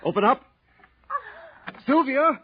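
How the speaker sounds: high, urgent shouting; worried shout at her door; calling out. Acted. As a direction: call to her through the door. Banging on the door.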